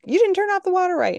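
high, loud, fast teasing imitation; mocking daughter. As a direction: tease her by parroting her. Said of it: "give her crap".

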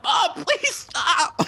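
very high, very slow mock sob through laughter; mock crying kid. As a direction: whimper it like a hammed-up crying kid. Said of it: "he's crying. He's like".

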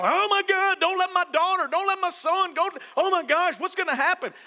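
high, loud, hammed-up parental panic; mock freaking out. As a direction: play a worried parent in a comic flap. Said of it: "people freaking out".

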